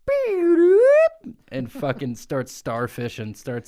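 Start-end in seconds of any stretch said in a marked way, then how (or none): 0.1-1.3 very high, loud squeaky creature beep. Retold or imitated. Grimace spotting Beast of Brusco, shrill creature beep. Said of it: "and Grimace is like".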